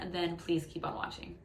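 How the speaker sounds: usual speaking voice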